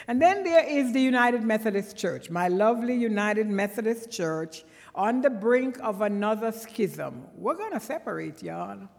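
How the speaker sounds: fervent sermon climax; preaching build. Acted. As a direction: drive it home from the pulpit. Preaching on the church's split.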